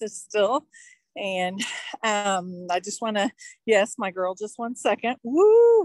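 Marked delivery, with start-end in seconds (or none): 5.2-5.8 high loud woo; excited whoop. Real, not acted. Host cheering for her girl, loud whoop. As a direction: let out a happy cheer.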